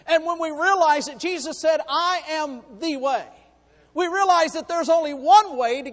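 loud, rising preacher's cry; preaching at full force. Acted. Preacher crying out to the church about sin, loud and fervent.

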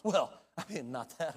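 ordinary delivery

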